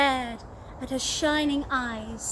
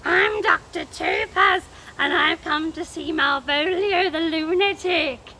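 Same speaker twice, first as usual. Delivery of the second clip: louder, odd put-on gruff call; strange gruff voice; 3 semitones higher, 3 dB louder than usual. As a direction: disguise the voice as a doctor. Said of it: "in a strange, gruff voice".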